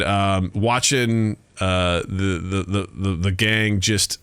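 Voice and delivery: normal talking voice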